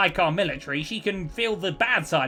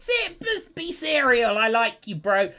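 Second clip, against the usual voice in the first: lightly voiced laid-back leader; 6 semitones higher, 4 dB louder, slower than usual. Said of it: "He said".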